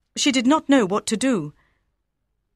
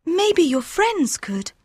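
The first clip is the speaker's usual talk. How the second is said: much higher, gentle female voice; tender young woman; 9 semitones higher, slower than usual. A narrator's reading. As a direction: Aouda reassuring Fogg. Aouda voice, slow.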